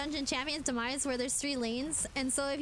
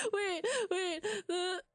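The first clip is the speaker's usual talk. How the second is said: laughing while talking; 6 semitones higher, slower than usual. Real, not acted. Laughing hard.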